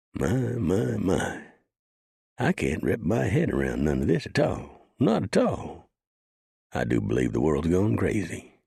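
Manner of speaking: high, wondering deputy voice; amazed old deputy. Acted. Rio voiced in disbelief.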